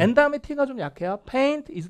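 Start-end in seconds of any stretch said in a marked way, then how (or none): 1.3-1.9 "Paint" high, dropping steeply into "is"; sharp falling tune. Shown as a demonstration. Teacher modelling sentence intonation, falling "Paint is". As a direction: let "Paint is" fall away from a high start. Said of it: "뚝 떨어져요".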